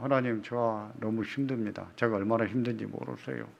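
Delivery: softly complaining to God; a tired, quiet plea; murmured prayer of exhaustion; hushed weary prayer. Acted.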